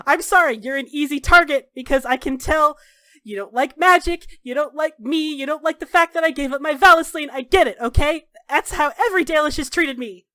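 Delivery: worked-up, loud defensiveness; heated, bitter apology. Acted. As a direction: pile up grievances with rising frustration. Acted heated apology turning bitter.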